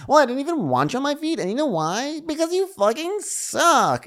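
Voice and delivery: very high put-on voice scolding her shoes, slowing at the end; shrieking scold.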